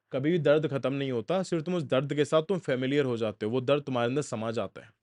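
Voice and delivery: ordinary talk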